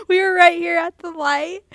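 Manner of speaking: breaking into laughter mid-story; laughing while talking. Real, not acted. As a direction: talk through the giggles.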